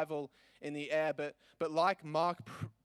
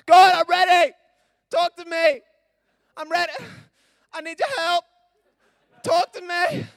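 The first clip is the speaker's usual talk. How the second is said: much higher, louder, hammed-up pleading to God; mock desperate prayer; 12 semitones higher, 15 dB louder than usual. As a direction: overact a desperate cry for a lightning bolt. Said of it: "and you're like".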